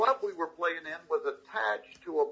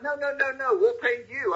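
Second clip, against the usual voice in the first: higher-pitched imitated reassurance; quick correction; 8 semitones higher than usual. Publisher setting a confused author straight, higher-pitched imitated reassurance. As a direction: wave off the mistake in a higher voice.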